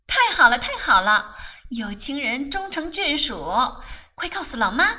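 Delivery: loud, excited cheering; overjoyed. A narrator's reading. Younger sibling hears of the engagement, overjoyed. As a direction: burst out with delight at full volume. Rose rejoices at the engagement news.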